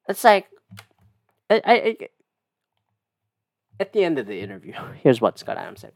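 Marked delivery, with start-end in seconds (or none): none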